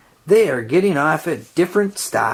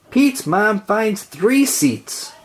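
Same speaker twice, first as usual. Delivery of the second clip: plain reading voice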